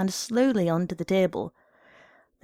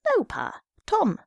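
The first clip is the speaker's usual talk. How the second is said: put-on little boy; 11 semitones higher, slower than usual. A narrator's reading. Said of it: "A little boy came running down".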